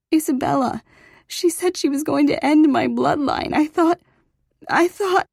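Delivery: high, loud, shaky-with-fear telling; frightened outburst. A narrator's reading. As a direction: blurt out the threat in rising panic. Frightened daughter explains threat.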